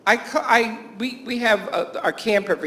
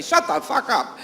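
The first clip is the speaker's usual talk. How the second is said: higher blurt, laughing; imitated tic outburst; 7 semitones higher than usual. Camp counselor's tic when somebody starts talking to her, imitated outburst. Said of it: "her tic is whenever somebody starts talking".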